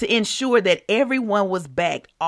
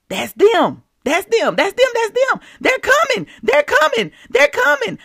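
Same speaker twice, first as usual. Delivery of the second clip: rising higher and louder, more eager each time; growing excitement; 13 semitones higher, 4 dB louder than usual. Retold or imitated. Parade crowd hearing the band coming, excitement building.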